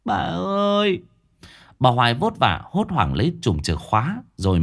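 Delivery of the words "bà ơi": high, plaintive cry for grandma; tearful child. A narrator's reading. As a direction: call out like a boy welling up. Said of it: "lại trực trào nước mắt".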